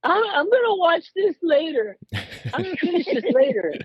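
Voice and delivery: very high-pitched mock kid voice; imitating his young son. Dad quoting his nine-year-old bailing on a film, high kid voice. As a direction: put on a squeaky little-boy voice making excuses. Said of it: "He'll be like".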